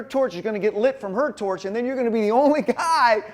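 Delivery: loud, rushed, high briefing; loud pumped-up relay official; excited instructions. Retold or imitated. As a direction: hype him up with every step of the route. Retelling loud torch relay instructions.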